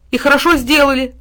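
high, loud comeback; shouted retort. A narrator's reading. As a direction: shout back defiantly. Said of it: "прокричал в ответ пёс".

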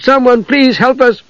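high frightened shout; desperate plea. A narrator's reading. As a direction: call out pleading into the dark. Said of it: "Usha cried".

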